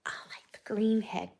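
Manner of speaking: hushed whisper; confiding whisper about ugly birds; whisper aside. Real, not acted. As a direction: let them in on a secret.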